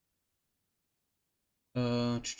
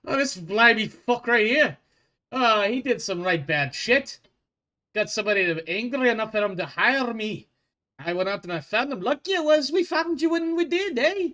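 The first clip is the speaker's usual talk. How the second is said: high, loud Scottish dwarf boast; a bounty hunter bragging about his catch; high loud Scottish brogue; Scottish accent; 7 semitones higher, 5 dB louder than usual. Acted. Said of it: "It's a blending".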